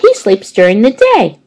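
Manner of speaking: put-on explaining like a know-it-all kid; schoolgirl Heidi. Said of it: "said Heidi".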